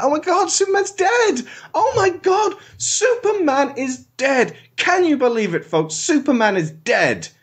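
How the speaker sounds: high, hysterical newsreader wail; hammed-up mock panic. Telling the folks Superman died, hammed-up mock panic. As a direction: shriek it like a frantic announcer.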